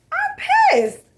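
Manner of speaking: over-the-top squeal of annoyance; exaggerated outrage. Real, not acted. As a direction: shriek the complaint in comic outrage.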